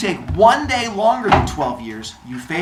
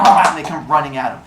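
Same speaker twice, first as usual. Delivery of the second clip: ordinary delivery, nothing marked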